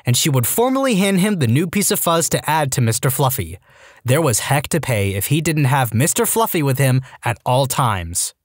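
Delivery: plain reading voice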